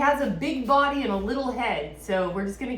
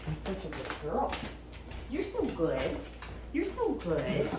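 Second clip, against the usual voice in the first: squeaky praising a dog; 3 semitones lower, 9 dB quieter than usual. Real, not acted. Coaxing the dog to come.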